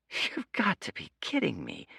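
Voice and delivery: disbelieving. Acted. Dorian disbelieving.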